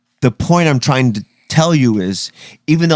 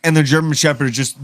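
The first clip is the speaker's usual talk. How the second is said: ordinary delivery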